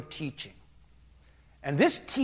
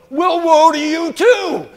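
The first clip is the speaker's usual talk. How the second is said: high, slow, full-volume denunciation; hammed-up thundering rebuke; letting him have it; 11 semitones higher, 14 dB louder, slower than usual. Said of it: "he lets him have it".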